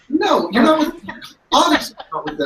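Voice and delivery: high, incredulous retort; flustered retort. Acted. Captive character answers the players' question, flustered and high. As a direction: blurt it out high, as if caught out.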